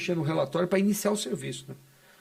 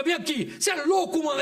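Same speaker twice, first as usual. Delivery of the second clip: grito agudo de enquadro; grito no noia; 9 semitones higher than usual. Retold or imitated. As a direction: grita com o suspeito. (grito de enquadro)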